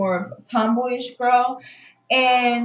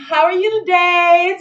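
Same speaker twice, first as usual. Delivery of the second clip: normal talking voice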